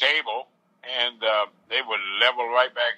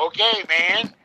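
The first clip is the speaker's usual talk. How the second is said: phone speaker; 9 semitones higher, slower than usual. Real, not acted. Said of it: "I have you on speakerphone".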